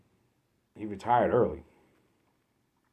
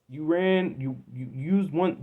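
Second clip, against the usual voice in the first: usual speaking voice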